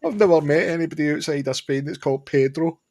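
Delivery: high-pitched, amused amazement; mock incredulity. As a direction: marvel aloud at a ridiculous name. Retold reaction to the name Pedro.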